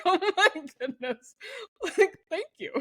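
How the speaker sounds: high, laughing thank-you; laughing amazement. Retold or imitated. Woman thanking Nathan for the house, laughing high. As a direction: giggle through grateful surprise.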